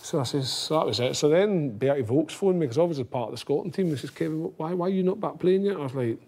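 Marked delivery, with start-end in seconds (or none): 4.1-5.8 half-attempted German accent, higher pitched; a hint of German accent, pitched up. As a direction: imitate a German coach, only lightly accented. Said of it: "Is that a wee German accent".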